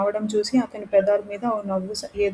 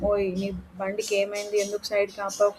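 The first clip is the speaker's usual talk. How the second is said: usual speaking voice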